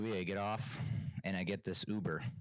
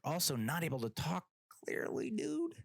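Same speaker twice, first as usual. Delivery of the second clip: high, incredulous complaint; pleading exasperation, pitched way up; exasperated; 8 semitones higher than usual. Retold or imitated. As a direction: climb high in disbelief. Exasperated rant at unclear driver.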